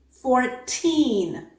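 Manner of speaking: put-on "fourteen" with a crisp t and long stressed TEEN; second syllable stressed. Shown as a demonstration. Teacher contrasting two words, "fourteen" stressed on TEEN with true t. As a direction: hit TEEN in "fourteen", no flap. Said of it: "the second vowel is stressed".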